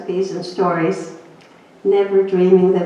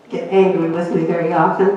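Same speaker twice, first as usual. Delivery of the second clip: ordinary talk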